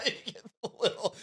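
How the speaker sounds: high, quiet words through laughter; fast squeezed talk while cracking up; real giggling fit over the words; laughing. Real, not acted. Cracking up at own joke.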